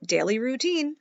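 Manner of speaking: put-on lively read-aloud for kids; animated children's story reading; story narration. A narrator's reading. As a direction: read it like a bedtime picture book. Reads picture book to children.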